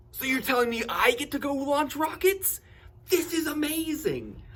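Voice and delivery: very high, loud, giddy outburst; hammed-up squeal of can't-believe-my-luck joy; thrilled disbelief. Retold disbelieving thrill at launching rockets.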